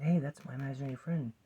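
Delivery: lightly voiced high-pitched recognition; retold child's surprise. Child to mom over photo album, mild surprised exclamation. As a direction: pipe up as a child spotting someone familiar.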